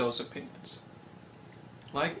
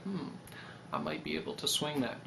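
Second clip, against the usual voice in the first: low, soft aside to himself; quiet musing; 5 semitones lower, 11 dB quieter, faster than usual. Retold or imitated. Thinking he can afford it, low soft musing. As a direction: mutter it like a private calculation.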